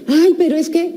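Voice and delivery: put-on high-pitched child's whine of protest. Child objects to being sent to bathe, protesting. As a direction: pitch it up like a kid starting an excuse.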